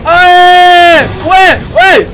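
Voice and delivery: high, loud yelps of fright; startled screams. Real, not acted. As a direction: yelp as if ambushed. Jump scare, screaming. (gritos de susto)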